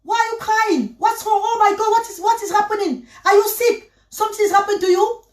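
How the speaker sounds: high, alarmed English questions from the neighbour; worried neighbour. Retold or imitated. Neighbour finding her crying asks what's wrong, high and alarmed. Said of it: "Elle dit que".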